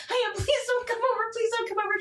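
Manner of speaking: high-pitched frantic repeated plea; acted-out stressed begging; stressed pleading. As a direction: beg in a panic, voice pushed way up. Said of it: "you sound stressed".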